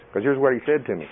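usual speaking voice

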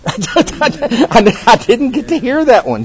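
high laughing protest; laughing that he missed that one. Real, not acted.

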